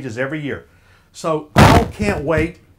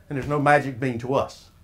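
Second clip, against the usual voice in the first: usual speaking voice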